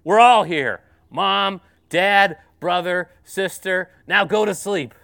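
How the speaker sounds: loud booming command, like a voice from above. Acted. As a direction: boom it out to silence the kids. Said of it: "booming voice comes out and says".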